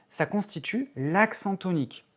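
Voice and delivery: ordinary delivery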